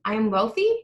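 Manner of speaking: "wealthy" lifted at the end, as if asking; questioning rise. Shown as a demonstration. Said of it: "it goes up and it sounds like a question".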